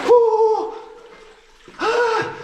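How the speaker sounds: sharp high gasps from icy water; cold gasp. Real, not acted. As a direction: gasp at the freezing shock.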